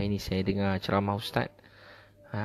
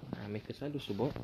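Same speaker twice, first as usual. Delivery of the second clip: "Ha, mereka selalu sebut" said much softer, almost to himself; 12 dB quieter than usual. Real, not acted. Ustaz repeating the point to the congregation, much softer. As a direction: drop the volume right down on the repeat. Repeated line, second take dropped.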